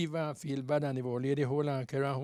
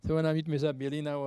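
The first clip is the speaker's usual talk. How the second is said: ordinary delivery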